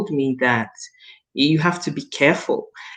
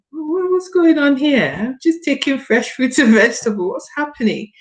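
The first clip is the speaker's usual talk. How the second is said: amazed puzzlement; 9 semitones higher than usual. Retold or imitated. Retelling to Pastor Sheila her shock at losing weight, high and amazed. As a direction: ask it like you can't believe your own body.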